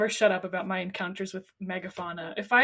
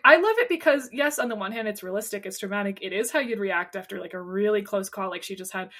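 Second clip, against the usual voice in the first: ordinary talk, nothing marked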